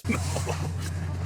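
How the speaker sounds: quiet, voiceless laugh; soft laugh under the breath. Real, not acted. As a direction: laugh softly, almost without voice. Laughs at what is shown.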